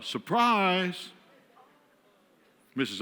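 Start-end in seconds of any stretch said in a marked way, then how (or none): 0.0-1.1 higher, drawn-out mock shout; imitated yell of surprise; mock crowd yell. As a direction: call it out like a surprise-party crowd, half-voiced. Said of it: "Three dozen people yell".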